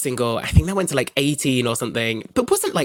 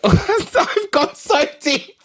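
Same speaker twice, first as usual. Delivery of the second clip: helplessly giggly, high and drawn out; laughing; 13 semitones higher, much slower than usual. Real, not acted. Host confessing an obsessive slideshow to the co-host, laughing. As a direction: squeeze the words out through laughter.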